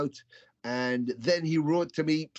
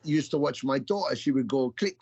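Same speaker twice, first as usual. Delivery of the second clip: usual speaking voice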